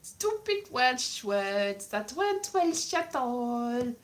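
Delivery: slow, drawn-out fake Welsh accent; cod Welsh. Comic mocking fake television Welsh, cod accent. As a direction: stretch it in mock Welsh. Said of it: "Cod Welsh".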